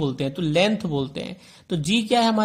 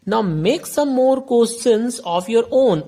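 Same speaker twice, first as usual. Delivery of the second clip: very slow, deliberate reading of the riddle; slow question read; 5 semitones higher, 5 dB louder, much slower than usual. Shown as a demonstration. Teacher reading the riddle aloud to the class, very slowly.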